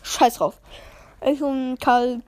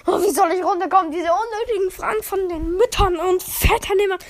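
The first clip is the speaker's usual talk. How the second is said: exasperated; 6 semitones higher, 3 dB louder than usual. Retold or imitated. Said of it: "Ich so".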